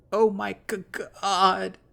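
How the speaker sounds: high, loud choked wail; choked shrill crying. Acted. Heather bawling in front of Jeremy, loud choked wail. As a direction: cry it out, choking on the word. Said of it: "her whining cry increasingly shrill and choked".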